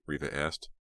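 plain reading voice

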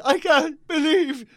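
high, slow, whiny put-on voice; hammed-up self-pitying complaint; mocking snowflake.

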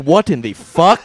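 loud hammed-up disbelief, laughing; comic appalled shout; shocked outburst. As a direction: burst out in mock outrage. Acted outrage of resurrected founding fathers.

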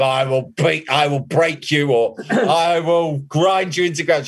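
hammed-up melodramatic menace; mock-dramatic villain threat; overblown threat. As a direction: ham up the threat, big and theatrical. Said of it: "overblown kind of".

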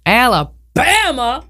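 drawn-out, high-pitched movie-quote Alabama; Forrest Gump. Retold or imitated. Host announcing the state, Forrest Gump-style drawl. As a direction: stretch it out and pitch it up. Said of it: "Forrest Gump".

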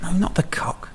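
breathy whispered aside. Real, not acted. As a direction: hush it right down.

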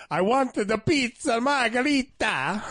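drawn-out mock Italian order; Italian accent. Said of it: "That's what I'll do next time".

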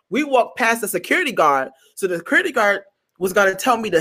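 ordinary delivery

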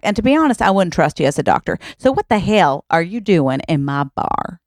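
put-on feisty Southern-accented challenge, lively pitch swings. Barmaid grilling two outlaws in her bar, Southern-accented challenge. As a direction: lay on a Southern accent and interrogate them. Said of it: "his mother has a Southern accent".

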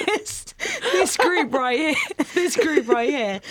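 slow, high words squeezed through laughter; cracking up. Real, not acted. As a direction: try to finish the sentence while laughing. Cracks up while trying to finish.

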